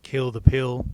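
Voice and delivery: ordinary talk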